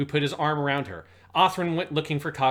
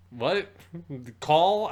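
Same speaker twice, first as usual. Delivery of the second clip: normal talking voice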